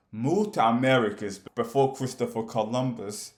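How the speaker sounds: ordinary talk, nothing marked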